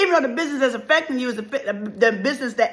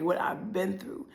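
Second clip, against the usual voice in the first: normal talking voice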